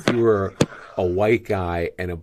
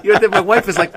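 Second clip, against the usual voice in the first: laughing; 12 semitones higher, 10 dB louder than usual. Real, not acted. Laughing while retelling the joke.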